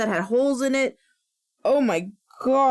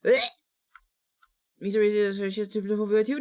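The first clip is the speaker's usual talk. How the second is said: normal talking voice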